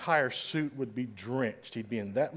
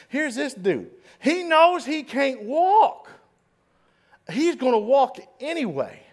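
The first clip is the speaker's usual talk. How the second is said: preaching at full force; 7 semitones higher, 5 dB louder than usual. Acted. Preacher rousing the congregation with Roosevelt's story, full force. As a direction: build the congregation up with fire.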